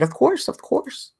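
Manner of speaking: high, cheery mock answer; mock eager reply. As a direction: toss it back in a playful put-on voice. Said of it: "I answered".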